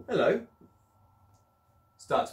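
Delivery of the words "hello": put-on boy's greeting. Boy's line read from board.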